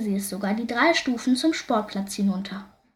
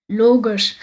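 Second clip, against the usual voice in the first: mocking; 8 dB louder than usual. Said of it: "spottete Julian".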